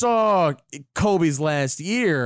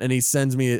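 ordinary delivery

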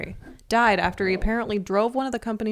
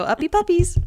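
put-on baby talk; 6 semitones higher than usual. Calling the dog up.

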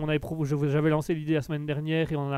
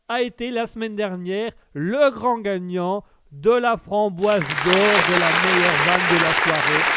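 loud, raised award-show announcement; announcer; 4 semitones higher, 8 dB louder than usual. Acted. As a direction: proclaim the champion over the crowd, drawing out the title. Announces last week's champion.

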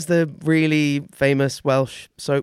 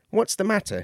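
a high, lightly voiced curious devil; 9 semitones higher than usual. A devil asks a lost old lady, lightly curious. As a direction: ask it with light curiosity, higher. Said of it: "he asked out of curiosity".

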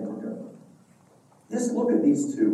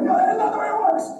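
much higher, louder, fast mock exasperation; exasperated no; 5 dB louder, faster than usual. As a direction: burst out in comic exasperation. God rejects avoiding home.

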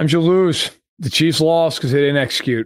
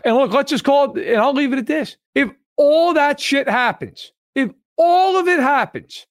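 much higher and building, pressing the point hard; rising excited rant; 9 semitones higher than usual. Real, not acted. Co-host hammering home why the Chiefs lost, excited. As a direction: pitch climbs as the argument peaks. Co-host ranting about the loss.

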